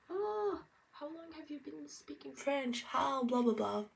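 high-pitched imitation of an astonished questioner; mock amazement. People in France asking about her French, mock amazement. Mimicking the same repeated question.

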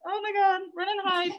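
high-pitched, hammed-up fright; mock terror. Zoo keeper joking how scary bats are, mock terror. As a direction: pretend to panic, high-voiced and half laughing.

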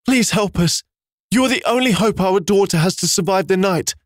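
high, frightened desperate plea; mother begging the beast to save her daughter; trembling in fear. A narrator's reading. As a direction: beg with your voice shaking. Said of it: "as she trembled in fear".

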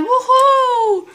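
high, gleeful woohoo; excited cheer. Real, not acted. Gamer celebrating a new life, a high excited cheer. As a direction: cheer like you just won.

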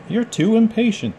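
high mocking tease of the engines; teasing engines. Engines mocking Thomas, high teasing voice.

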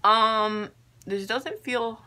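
ordinary talk

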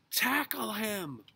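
high, drawn-out loud shout; urgent yell. A narrator's reading. Robert tangled in thorns, yelling to Henry. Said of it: "yelled Robert".

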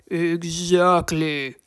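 low, slow gravelly troll; satisfied creature drawl; Torque. A narrator's reading. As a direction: draw the word out with a knowing troll growl. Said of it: "deep and gravelly voice".

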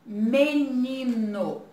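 "menino" drawn out slowly; slow dictation. Shown as a demonstration. Teacher dictating the word to children, slowly. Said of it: "vão encontrar a palavrinha".

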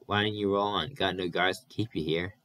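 ordinary delivery

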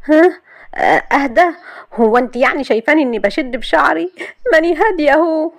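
high, flustered denials; flustered jealous wife denying it to her friend; nervous. Acted. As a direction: stammer out a nervous cover. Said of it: "قالت ماسة بتوتر".

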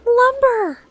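high gasping excitement; excited discovery. Real, not acted. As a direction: squeal over the find. Finds lumber.

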